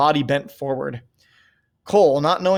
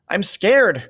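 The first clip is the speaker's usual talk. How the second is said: higher, louder frightened cry; scared cry; 5 semitones higher, 5 dB louder than usual. A narrator's reading. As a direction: cry out in fear. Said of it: "cried Peter".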